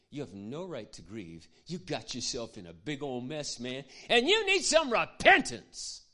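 builds slowly to a loud preachy yell. Retold or imitated. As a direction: scold like a self-righteous churchgoer.

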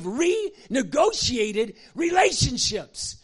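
pulpit-pounding preaching shout. Real, not acted. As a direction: hammer it home to the congregation. Preacher rising to full force.

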